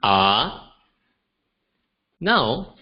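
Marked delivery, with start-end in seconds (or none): 0.0-0.7 "ah" as Mandarin second tone, rising. Shown as a demonstration. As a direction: slide "ah" upward like a question. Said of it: "it sounds like".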